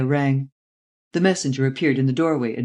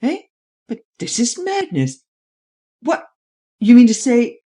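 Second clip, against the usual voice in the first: high-pitched, broken exclamations of shock; jolted into disbelief, bursting out louder at the end; startled; 9 semitones higher, 6 dB louder than usual. Acted. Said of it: "Valenglay started".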